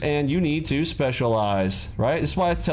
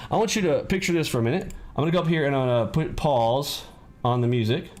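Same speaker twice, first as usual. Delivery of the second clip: normal talking voice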